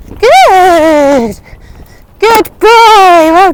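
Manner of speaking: loud, high cheer for the horse; shouted praise. Real, not acted. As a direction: shout it out proudly. Praising horse after a jump.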